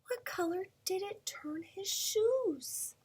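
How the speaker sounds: unvoiced hushed question; whisper. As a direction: whisper it for suspense.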